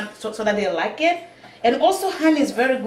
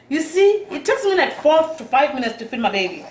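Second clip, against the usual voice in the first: ordinary talk, nothing marked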